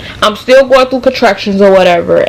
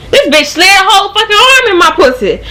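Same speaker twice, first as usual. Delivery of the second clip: excited outburst; 13 semitones higher than usual. Real, not acted. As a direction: blurt it out in high excited disbelief. Excited climax of birth story.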